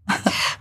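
short quiet laugh; a brief soft chuckle. Real, not acted. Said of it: "أضحك كذا". (ضحكة قصيرة خافتة)

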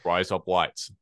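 demonstrated Australian accent on "Rise up lights". Shown as a demonstration. Teaching a friend an Australian accent, demo accent. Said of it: "teach somebody an Australian accent".

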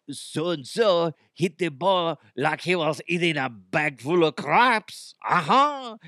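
slow, ridiculous French-accented commentary; Frenchie DeHuff. Shown as a demonstration. Fake analyst giving tennis analysis, hammy French accent. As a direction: stretch it out in a silly French accent. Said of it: "So that was Frenchie DeHuff".

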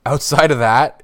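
normal talking voice

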